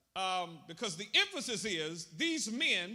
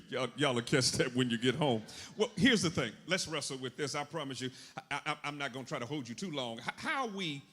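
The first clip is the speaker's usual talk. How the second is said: normal talking voice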